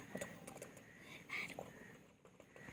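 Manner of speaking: barely audible whispered coo. Real, not acted. Owner hushing her pet, whispered coo. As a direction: hush it out with no voice at all.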